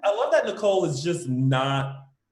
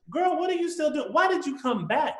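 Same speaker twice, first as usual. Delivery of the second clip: exasperated scolding; 12 semitones higher than usual. Retold or imitated. Retells her exasperation at character.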